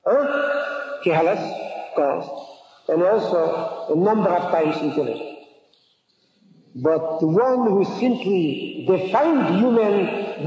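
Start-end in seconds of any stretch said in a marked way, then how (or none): none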